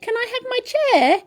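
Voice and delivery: usual speaking voice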